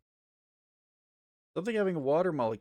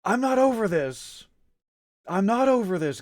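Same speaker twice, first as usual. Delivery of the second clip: higher, louder frustrated shouting; meltdown yell; 8 semitones higher, 5 dB louder than usual. Real, not acted. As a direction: vent the frustration loudly. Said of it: "I'm having a fucking meltdown".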